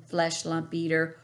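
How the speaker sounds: ordinary delivery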